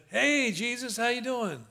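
high, breezy mock hello; casual chirpy greeting. Preacher joking about meeting Jesus, chirpy mock greeting. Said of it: "And I said".

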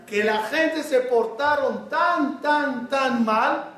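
"tan, tan" stretched slowly and stressed; slow on each "tan" to show how bad; preacher stretching "tan, tan mal" slowly; drawn-out tan. Shown as a demonstration. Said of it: "drawn emphasis on tan".

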